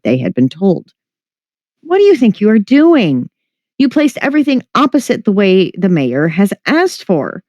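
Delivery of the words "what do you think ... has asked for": higher-pitched scolding yell, kept in check; indignant mild yell at careless workers. A narrator's reading. Maid scolds the movers for setting it up backwards, yelling. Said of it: "Verona yelled at them".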